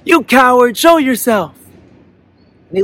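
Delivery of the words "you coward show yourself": high, loud challenge thrown back; echoed angry shout. A narrator's reading. Echo repeats the boy's challenge, shouting angrily. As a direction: mimic the angry yell as an echo. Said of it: "he hears the voice go".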